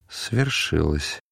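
slow, soft and sorrowful; quiet, grieving voice; sad, soft. A narrator's reading. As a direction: say it gently, low and drawn out. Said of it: "сказал грустный, мягкий голос".

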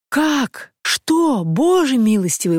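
high-pitched cries of disbelief; astonished exclamation. A narrator's reading. Travellers hearing Anna is here, astonished cries. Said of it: "воскликнули Блистовский и Анна Андреевна".

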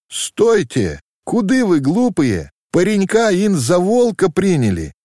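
mock them as they run; mocking call. A narrator's reading. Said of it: "насмешливо послала последняя им вдогонку". (насмешливый окрик вдогонку, выше)

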